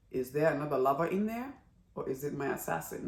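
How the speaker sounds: a king quietly probing; hushed king voice. Retold or imitated. King quietly pressing the girl, soft and quick.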